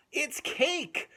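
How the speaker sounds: high, loud delighted cry; excited cry. Acted. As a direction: shout out the discovery. Gasp at the cake.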